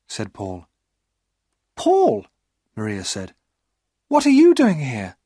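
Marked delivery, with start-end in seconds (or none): 1.7-2.3 much higher, louder woman's voice; startled young woman calling his name; surprised high female voice; Maria's surprised voice. Acted. 4.1-5.2 much higher, louder woman's voice; startled young woman calling his name; surprised high female voice; Maria's surprised voice. Acted.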